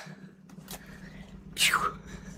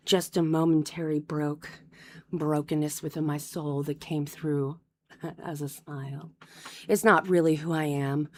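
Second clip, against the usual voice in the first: low, much softer, gloomy deadpan; hammed-up brooding murmur; mock emo gloom; 9 semitones lower, 9 dB quieter than usual. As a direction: sulk through it like a tragic emo kid. Mock emo gloom after song.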